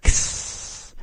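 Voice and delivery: Ks breathed out soft and voiceless, no vowel; a quiet unvoiced Ks hiss; teacher demonstrating a soft Ks. Shown as a demonstration. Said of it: "It's real soft, quiet".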